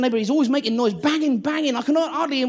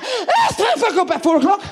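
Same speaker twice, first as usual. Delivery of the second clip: higher sneeze-blurted words, laughing; mock sneeze voice; 6 semitones higher than usual. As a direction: let the sneeze push words out. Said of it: "You go like".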